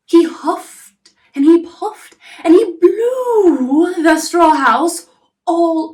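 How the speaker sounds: loud, high, drawn-out huffing narration; huffing and puffing. A narrator's reading. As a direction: blow the words out big and slow. Wolf blows the house down.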